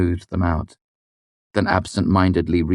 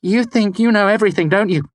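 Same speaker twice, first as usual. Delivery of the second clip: high, loud accusing demand; 6 dB louder than usual. A narrator's reading. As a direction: corner him with it. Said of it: "she demanded".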